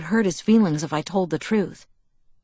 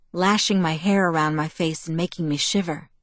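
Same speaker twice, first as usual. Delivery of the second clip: plain reading voice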